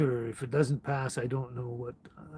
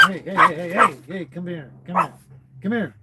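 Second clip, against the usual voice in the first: loud, higher call to the dog; 4 semitones higher, 9 dB louder than usual. Real, not acted. Streamer calling the dog over, loud and high. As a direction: call her over, raised and eager.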